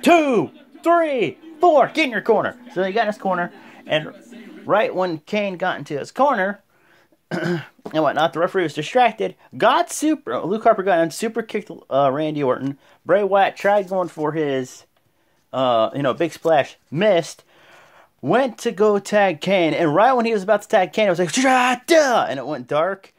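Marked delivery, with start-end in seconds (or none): 0.0-2.6 high, loud mock shouted commands and count; referee barking orders. Referee sending Kane to his corner, shouted count. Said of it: "The ref's like". 21.3-22.3 lights-out cry. Retold or imitated. Wyatt lights-out effect.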